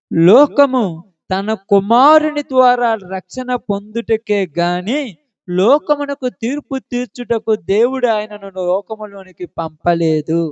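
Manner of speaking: slow, measured Bible-verse reading; scripture recitation. Real, not acted. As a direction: recite the verse slowly, opening loud on the first word. (నెమ్మదిగా, సాగదీస్తూ Bible వాక్యం చదవడం)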